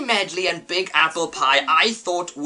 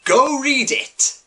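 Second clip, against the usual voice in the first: emphatic command; 8 semitones higher, 4 dB louder, slower than usual. Real, not acted. Emphatic command after a pause.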